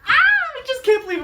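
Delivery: high, loud burst of disbelief; giddy high exclamation; overjoyed squeal. Real, not acted. Unboxing, overwhelmed excitement.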